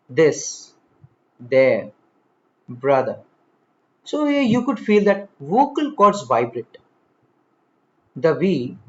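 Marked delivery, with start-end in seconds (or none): 0.1-3.2 "this", "there", "brother" with a buzzed TH; voiced TH. Shown as a demonstration. Teacher showing the voiced sound, carefully articulated. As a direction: voice the TH in each of "this", "there", "brother", word by word. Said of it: "now into voiced".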